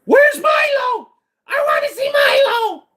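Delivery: very high, slow, wailing shout; impression of Fab Fest U S. Streamer imitates a chatter demanding Milo, high crying shout. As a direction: squeal for Milo like a whiny kid. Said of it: "Can I do impression of Fab Fest U S?".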